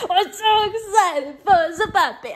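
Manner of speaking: high, loud, laughing and teary; excited squeal. Real, not acted. Squealing about getting a puppy.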